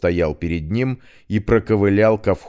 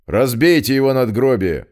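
loud barked order; commanding order; 5 semitones higher, 4 dB louder than usual. Acted. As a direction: order the men sharply. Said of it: "приказал Петрович". (властный приказ)

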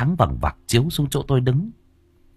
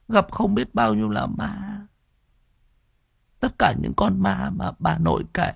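put-on weak feverish child; 10 semitones higher than usual. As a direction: murmur it weakly from a sickbed. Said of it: "Tôi thều thào".

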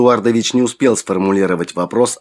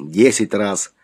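plain reading voice

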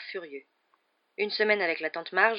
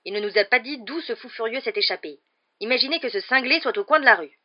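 higher, louder outraged uncle; indignant rant; 5 semitones higher, 9 dB louder than usual. A narrator's reading. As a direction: rant indignantly at the newsreader, voice rising. Uncle rants at the news.

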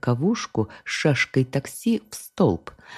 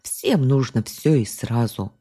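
plain reading voice

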